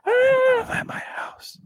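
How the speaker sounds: high, slow frightened voice; scared caller voice. Acted. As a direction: squeak it out like a terrified caller. Caller in 911 improv.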